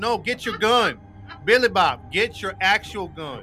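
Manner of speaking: high, slow country yokel; hammed-up hillbilly ordering a buddy. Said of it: "Why you gotta be Billy Bob?".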